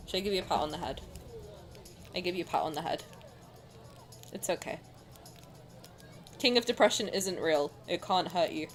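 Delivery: low, soft reassurance; gentle comforting. Real, not acted. Streamer offering a pat on the head, gentle soothing. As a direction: soothe them quietly, pitch dropped. Comforting viewers after sad story.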